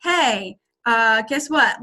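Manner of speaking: dull, hesitant delivery; flat, no enthusiasm at all; unexcited. Retold or imitated. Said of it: "than if you're like".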